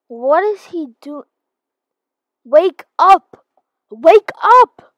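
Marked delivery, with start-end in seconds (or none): 2.4-3.5 loud yell to wake him; shouting. Acted. Waking a sleeping stranger. 3.9-4.9 higher, louder wake-up yell. Acted. Second, stronger wake call.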